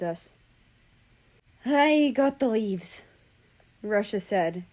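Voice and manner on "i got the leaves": loud, high and slow, acted breathlessness; out of breath. Russia returns with leaves, high, mildly out of breath. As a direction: deliver it as if lightly winded, pitched up. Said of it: "out of breath".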